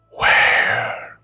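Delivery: each word whispered alone with long pauses; eerie whisper. Acted. Ghost beside the boy's bed, slow broken whisper. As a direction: creep it out word by word, barely voiced. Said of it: "the voice whispered".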